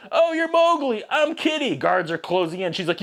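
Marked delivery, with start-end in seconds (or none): 0.1-1.7 high put-on coy girl voice; girly Kitty voice. Kitty introducing herself to Mowgli, high coy voice.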